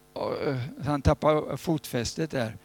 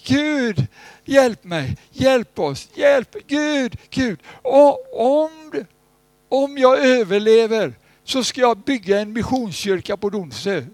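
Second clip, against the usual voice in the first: loud high desperate plea to God; a man in distress at sea vowing to God; crying out; 9 semitones higher, 8 dB louder, slower than usual. Retold or imitated. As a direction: shout for rescue from the sinking boat. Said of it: "han ropade".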